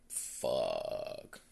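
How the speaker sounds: low, drawn-out curse of dismay; resigned curse. Retold or imitated. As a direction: let it sink out slowly, giving in. Mary reluctantly gives in to offer.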